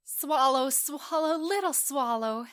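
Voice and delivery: high, loud call to a little bird. Acted. Prince summons the swallow, calling high.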